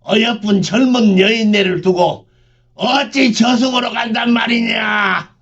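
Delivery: high shrill furious yell; sharp shout. Acted. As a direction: scream it out in protest. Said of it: "날카롭게 소리쳤다".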